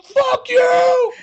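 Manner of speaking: high hammed-up shout; mock yell. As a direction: yell it over your shoulder while running. Character yelling running away.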